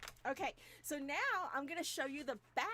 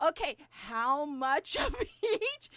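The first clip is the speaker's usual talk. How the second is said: laughing; 10 dB louder, slower than usual. Retold or imitated. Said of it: "and I'm like".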